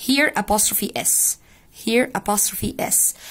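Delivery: usual speaking voice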